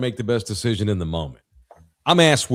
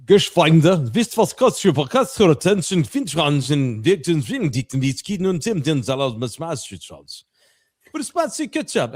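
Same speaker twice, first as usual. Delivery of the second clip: mock German; 4 semitones higher than usual. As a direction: bark out fake German words. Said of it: "I've been German guy too".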